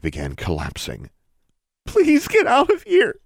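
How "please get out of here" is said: very high, loud, slow crying plea; girl begs her to go, crying. A narrator's reading. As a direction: beg through tears. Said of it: "the girl repeated, crying now".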